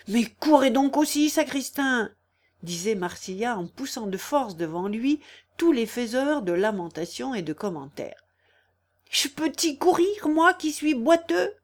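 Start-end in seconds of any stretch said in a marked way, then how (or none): 0.1-2.1 high, impatient urging; raised, pressing voice. A narrator's reading. Hurrying the sexton to the fire. 9.1-11.5 high, indignant complaint; pitched-up villager's objection; protesting sexton. A narrator's reading. Lame sexton protests being hurried.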